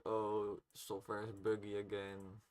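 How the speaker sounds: normal talking voice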